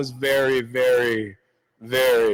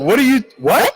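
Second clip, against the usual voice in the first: high, loud startled disbelief; loud baffled double take; shocked what; 10 semitones higher, 8 dB louder than usual. Retold or imitated. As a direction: blurt it out, stunned and confused. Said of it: "I'm like".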